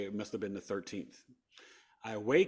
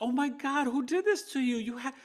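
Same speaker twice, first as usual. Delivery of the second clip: shocked kind nurse; 5 dB louder than usual. Retold or imitated. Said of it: "She's like".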